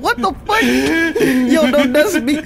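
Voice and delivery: high, loud, laughing panic; panicked yelling. Real, not acted. Gamer fleeing up a ladder after being spotted, panicked. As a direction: yell high and frantic, half laughing.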